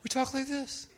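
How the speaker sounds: high and soft, demonstrating the wife-talk tone. Shown as a demonstration. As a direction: pitch it up gently, half laughing. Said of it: "You talk higher".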